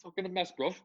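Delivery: lightly hammed-up London slang, rising high at the end; mock British accent. Host keeps the British bit going, mock London accent. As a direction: taunt like a cheeky British lad.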